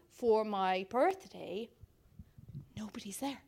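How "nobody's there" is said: whispered, voice dropping right away; a hushed, deflated whisper; stunned whisper. Retold or imitated. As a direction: breathe it out in disbelief. Empty pub, dropping voice.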